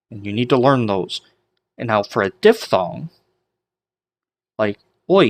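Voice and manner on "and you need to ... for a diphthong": ordinary talk, nothing marked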